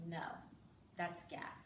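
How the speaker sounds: quiet deadpan; emotionless little boy. Retold or imitated. Son correcting parent's acting, quiet deadpan. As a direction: dismiss it like a stony, unmoved kid. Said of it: "He was like the Vin Diesel of children".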